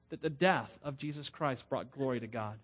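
ordinary delivery

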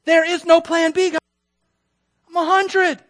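high, loud protest; acted desperation at full voice; exasperated shouting. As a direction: shout it as a hopeless objection. Acting Abraham arguing with God.

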